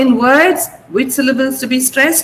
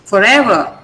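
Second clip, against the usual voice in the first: forever with EVER lifted then falling; stress on EVER. Shown as a demonstration. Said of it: "the ever is stressed".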